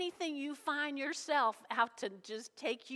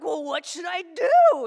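alarmed question; 4 semitones higher, 11 dB louder, slower than usual. Retold or imitated. Retelling her alarmed question.

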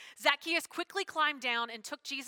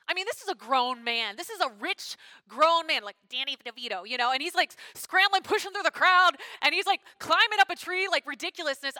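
animated mockery; 4 semitones higher, 9 dB louder than usual. Preacher mocking Zacchaeus climbing tree.

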